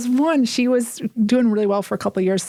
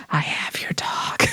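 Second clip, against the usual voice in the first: slow, breathy hushed whisper; cryptic whisper; 4 semitones lower, 5 dB quieter, slower than usual. Retold or imitated. Sponsee calling back about the dog, in a slow cryptic whisper. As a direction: hiss it low and slow like a secret threat. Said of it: "calls me back in this cryptic message".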